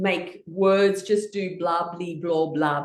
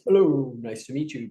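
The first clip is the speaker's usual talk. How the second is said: very serious; 7 semitones lower than usual. Retold or imitated. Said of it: "we used to shake hands very serious".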